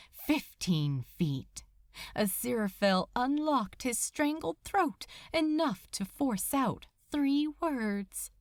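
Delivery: ordinary delivery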